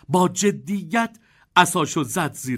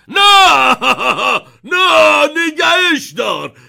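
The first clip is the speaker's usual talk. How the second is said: very loud, high, pleading yell; frantic shout; 11 semitones higher, 11 dB louder, slower than usual. A narrator's reading. Grandpa screams at Wonka to stop the lift. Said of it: "بابا بزرگ جو فریاد زد".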